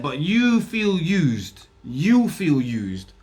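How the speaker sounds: you feel used stretched out very slowly; slow it right down to hammer the point; drawn-out emphasis. Retold or imitated. Scolding her, drawn out.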